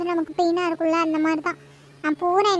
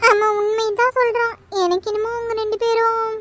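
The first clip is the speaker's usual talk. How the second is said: ordinary delivery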